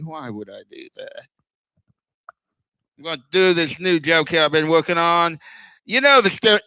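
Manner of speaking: usual speaking voice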